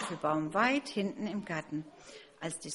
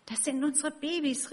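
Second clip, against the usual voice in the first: much higher, thrilled call; excited sparrow mother; 8 semitones higher than usual. Acted. Sparrow mother announces the hatching, high and excited. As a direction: burst out with joy. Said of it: "rief die Spatzenfrau ganz aufgeregt".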